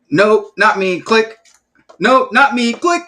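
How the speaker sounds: high, loud mocking "Nope, not me"; squeaky brush-off voice; mock hang-up. Mimicking prospects hanging up.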